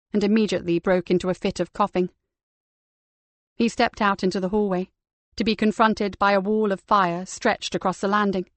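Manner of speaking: ordinary delivery, nothing marked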